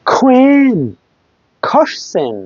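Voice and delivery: কুইন and কোশ্চেন with Q like খ; breath opening কুইন, then কোশ্চেন; aspirated K. Shown as a demonstration. Teacher drilling Q words, aspirated কুইন, কোশ্চেন. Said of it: "খর মতো উচ্চারণ হবে".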